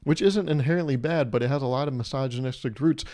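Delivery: usual speaking voice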